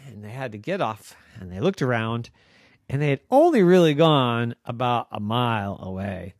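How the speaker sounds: plain reading voice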